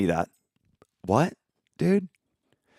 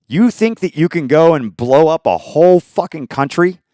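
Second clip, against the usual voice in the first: normal talking voice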